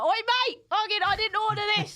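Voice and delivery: loud, high, rough London pub voice; hammed-up rude Brit complaining to a waiter; brash English. Complaining to a restaurant waiter in a loud, brash mock English voice. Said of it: "shocked at how I was in English".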